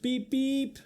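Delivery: high squeaky beep; squeaky moped beep. Acted. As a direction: squeak it like a tiny moped horn. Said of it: "squeaked the mopeds".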